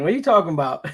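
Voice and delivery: normal talking voice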